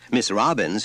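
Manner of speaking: ordinary delivery, nothing marked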